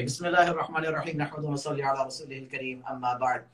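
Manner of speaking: usual speaking voice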